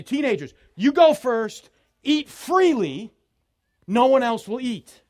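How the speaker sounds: loud, high, emphatic go-ahead; calling out. Acted. Host tells teenagers to eat first, calling out loudly. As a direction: send them to the food at full voice.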